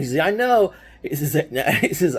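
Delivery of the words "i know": high, gleeful; victorious teen gloating; excited brag. Retold or imitated. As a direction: say it with smug delight. Brother triumphant after win.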